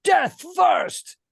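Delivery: high, loud defiant shout. Retold or imitated. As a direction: hurl it back in defiance. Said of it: "And you can hear Wesley saying".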